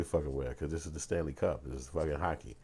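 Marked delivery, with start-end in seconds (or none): none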